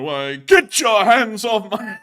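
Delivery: high, loud, slow mock outrage; hammed-up indignant bellow; heavy emphasis. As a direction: belt it out like an affronted stage actor. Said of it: "He puts so much emphasis into that last line".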